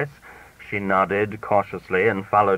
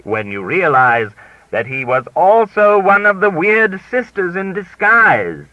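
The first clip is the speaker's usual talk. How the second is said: a touch of glee, rising louder to the end; gleeful; 12 semitones higher, 9 dB louder than usual. A narrator's reading. Narrator gloating to the American lady, mildly gleeful. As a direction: savour the reveal, a little louder. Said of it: "I said gleefully".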